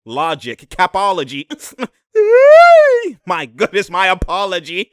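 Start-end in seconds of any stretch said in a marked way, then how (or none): 2.1-3.2 loud high laughing scream; cracking up loudly; shrieking laugh. Real, not acted. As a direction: burst into a high squeal of laughter. Reacting to replay, shrieking laugh.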